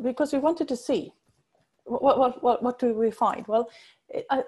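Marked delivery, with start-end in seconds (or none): none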